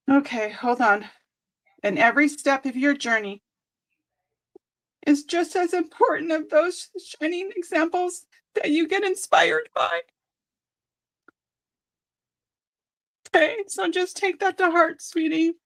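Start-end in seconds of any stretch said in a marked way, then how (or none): 5.1-15.5 fighting back tears while reading; tearing up. Real, not acted. Reading the card to a viewer, tearing up. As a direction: voice going high and teary. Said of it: "I'm tearing up".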